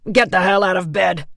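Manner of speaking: furious orderly yelling; angry shout. A narrator's reading. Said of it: "a second man shouted".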